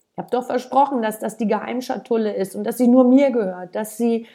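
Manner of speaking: put-on child yelling at his parents; angry shout. A narrator's reading. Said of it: "rief er".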